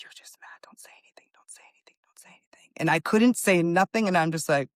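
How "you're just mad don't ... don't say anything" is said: whispered self-talk, barely audible; hushed whisper telling herself to hold back; inner voice whisper. Retold or imitated. Whispering to herself in the locker room to stay calm. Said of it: "in my mind, I'm like".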